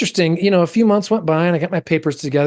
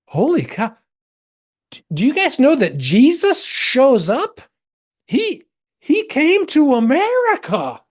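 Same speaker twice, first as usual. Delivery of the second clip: high, eager announcement; excited discovery; 11 semitones higher than usual. Retold or imitated. To district class, amazed and excited. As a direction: blurt it out like big news.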